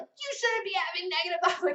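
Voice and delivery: lightly mock the self-scold; a hint of a scolding self. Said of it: "I don't, like, beat myself up".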